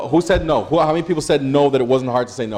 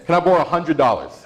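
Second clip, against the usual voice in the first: bigger ask; 5 semitones higher than usual. Shown as a demonstration. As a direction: raise the stakes in the voice. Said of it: "Again, okay".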